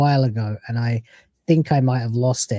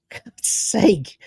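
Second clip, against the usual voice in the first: exasperated whisper; 5 semitones higher than usual. Real, not acted.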